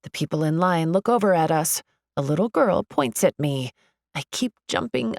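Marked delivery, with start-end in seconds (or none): none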